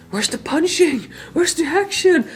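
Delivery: a put-on whiny viewer; bored moviegoer. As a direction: complain like an impatient casual fan. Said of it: "you would probably just be like".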